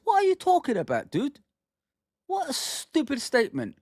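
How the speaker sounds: can't believe what you just heard; incredulous. Real, not acted. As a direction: pitch jumps way up in exasperated disbelief. Mocking the reporter's question.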